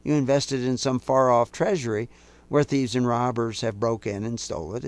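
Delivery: usual speaking voice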